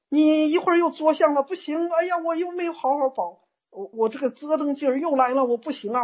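high-pitched mock lament; acted fretting. Practitioner scolding themself for slipping back, acted fretting.

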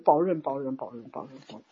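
low, fading mock chant; hushed repetition. Practitioner reminding themself not to forget, hushed repetition. Mimics practitioner repeating a watchword.

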